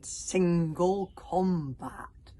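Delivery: "single combat" as a grand, slow challenge; dramatic emphasis. A narrator's reading. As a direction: weigh each word of "single combat". Dramatic offer of a duel.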